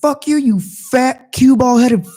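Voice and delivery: high, slow drawn-out taunting insult; mock insult. Taunting the bald driver back, high and drawn out. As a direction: stretch the insult out mockingly. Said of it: "And I was like".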